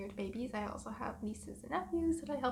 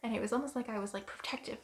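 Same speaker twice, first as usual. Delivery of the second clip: usual speaking voice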